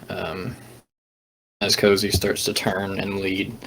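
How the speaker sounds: normal talking voice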